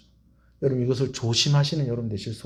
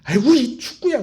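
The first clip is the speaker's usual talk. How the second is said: teasing teacher; 8 semitones higher, 4 dB louder than usual. Retold or imitated. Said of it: "선생님이".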